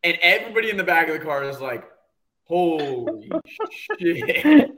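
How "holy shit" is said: slow, drawn-out laughing holy shit; laughing disbelief. Retold or imitated. Everybody in the back seat reacts, slow laughing shock. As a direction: stretch it out through a laugh.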